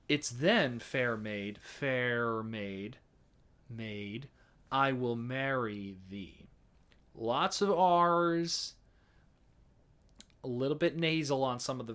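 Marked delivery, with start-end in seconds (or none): 0.1-6.5 plain American sung version; straight American. Shown as a demonstration. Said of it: "straight up American".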